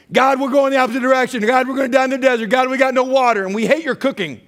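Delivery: high, loud, fast whiny complaint; mock whining. Preacher imitates Israelites griping to God, high, loud and fast. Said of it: "They throw a new wrinkle in here".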